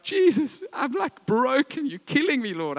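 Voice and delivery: high pleading lament, voice pushed up; anguished cry to Jesus. Retold or imitated. Believer in a hard season, crying out to Jesus in pleading anguish. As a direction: cry out to God as if at breaking point. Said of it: "go through these seasons of like".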